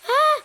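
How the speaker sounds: sharp high startled cry; loud startled yelp; sudden high yelp. Real, not acted. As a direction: yelp in surprise. Game moment.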